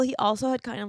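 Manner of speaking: ordinary talk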